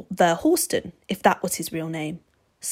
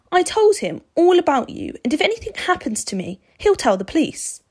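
put-on high, raised boy's voice; boy Steve, defiant; 10 semitones higher, 5 dB louder than usual. A narrator's reading. Boy warning the vampire about his friend, defiant. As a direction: warn him off as a defiant boy.

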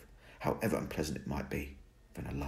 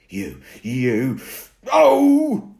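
sputtering, building to a loud high cry; lost for words, then explode; frustrated outburst; 10 semitones higher, 19 dB louder, much slower than usual. Acted. Boy furious, lost for words.

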